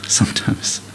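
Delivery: dry whispered aside about marriage. Real, not acted. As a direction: drop to a knowing whisper.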